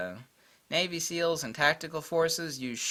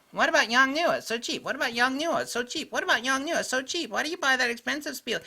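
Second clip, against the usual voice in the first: high-pitched sing-song mocking imitation; mocking pesterer; 12 semitones higher, 4 dB louder than usual. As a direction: mimic a nagging questioner in a high voice. Mimics pestering askers, exasperated.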